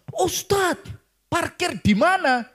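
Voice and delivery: high-pitched hail from afar; calling out. Retold or imitated. Committee member chasing the preacher about parking, calling out high. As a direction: call after someone walking away.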